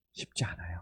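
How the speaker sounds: 속삭이듯 쉽지 않다고 털어놓기; a breathy aside, barely voiced; whisper. Real, not acted. Aside on giving thanks. (속삭이는 목소리)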